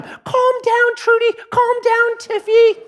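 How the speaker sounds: high cooing voice calming pet turkeys; soothing turkey owner. Retold or imitated. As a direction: coo to the birds in a high soothing voice. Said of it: "she's like the whole time".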